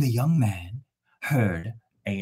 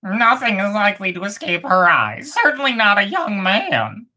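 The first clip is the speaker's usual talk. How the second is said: higher bird voice, knowing; other bird; 8 semitones higher than usual. Acted. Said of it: "said the other bird".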